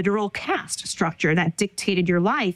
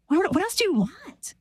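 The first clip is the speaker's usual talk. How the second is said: higher-pitched, exasperated retold plea; exasperated appeal; 10 semitones higher than usual. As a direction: throw it out as a frustrated question. Pleading with parents in retold argument.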